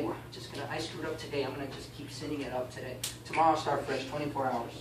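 softly mumbled excuse to oneself; low-key, give up on today; quiet, sinking into a shrug; resigned self-talk. Acted. Voices someone giving up on today.

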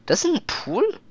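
high disbelieving question; is that really a pool; surprised. Real, not acted.

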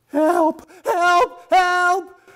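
high, loud panicked cries; screams for help. A narrator's reading. Robbed stranger screaming to the synagogue, loud panicked cries. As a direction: cry out for help in terror.

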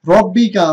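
রব্বিকা with a heavy রা; recited model word. Shown as a demonstration. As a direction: chant it for the class. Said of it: "তো চলুন আমরা কি বলবো?". (মোটা রা-তে পাঠ)

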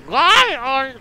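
high, loud, whining protest; exasperated cry. Real, not acted. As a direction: cry out in frustration, then give up. Exasperated at the game.

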